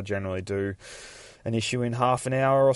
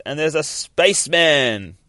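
hammed-up big announcement, higher and louder; excited reveal; 6 semitones higher, 7 dB louder than usual. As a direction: draw it out like a grand reveal. Excited reveal while retelling comic.

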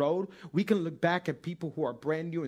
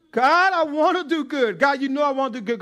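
louder, higher, earnest plea to God; pleading prayer; 8 semitones higher, 9 dB louder than usual. Pretend. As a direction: cry out to God wanting to be good. Praying prayer as a struggling believer.

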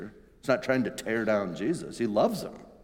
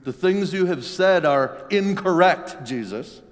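louder, higher, slow correction; stern rebuke; 7 semitones higher, 8 dB louder than usual. Retold or imitated. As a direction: scold him deliberately. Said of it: "is what Peter is telling him".

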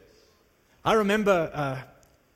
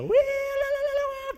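much higher, squealing la la la; imitating a cheering crowd. Preacher imitating townspeople celebrating a healing, high squealing cheer. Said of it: "People are freaking out and shouting".

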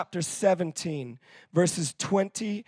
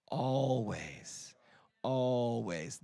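much lower and softer, lingering; a preacher pressing the word home quietly; low intense repeat; 6 semitones lower, 8 dB quieter, much slower than usual. Acted.

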